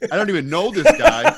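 high, loud panicked inner voice; panicked thought. Retold or imitated. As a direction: squeak it out in sudden panic. Inner panic at giant dude.

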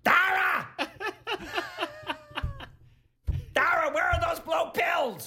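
loud, high, screeching Gilbert Gottfried voice; Gilbert Gottfried impression. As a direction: squawk it like Gilbert Gottfried doing pillow talk. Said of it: "do an imitation of me whispering sweet nothings".

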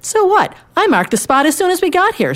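high-pitched indignant comeback; acted squabbling retort; arguing. A narrator's reading. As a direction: fire back in a high, heated character voice. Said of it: "Two men started arguing".

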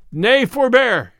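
slow, high, loud call; commanding cry. A narrator's reading. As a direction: call out to halt the fight. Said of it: "cried Robin".

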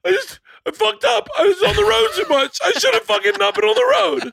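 very high, loud wailed confession; mock drunken sobbing. Drunk husband confessing to his wife, mock sobbing wail. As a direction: imitate yourself blubbering with regret, pitch way up. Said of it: "I am sobbing".

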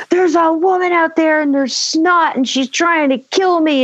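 put-on high, panicked kid pleading in one rush; breathless high-pitched alarm, acted out; frantic kid. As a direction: imitate a terrified child trying to be believed. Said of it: "I'm saying all these things".

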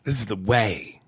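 usual speaking voice

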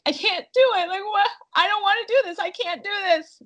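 high whiny whimpering plea; whimpery. Retold or imitated. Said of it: "a little bit whimpery".

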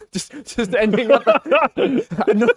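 laughing while talking; words broken by laughter. Real, not acted. Laughs through self-joke.

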